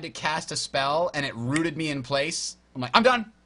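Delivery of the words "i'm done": loud fed-up outcry, pitched up; exasperated outburst. Retold or imitated. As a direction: throw up your hands and quit loudly. Frustrated quitting outburst.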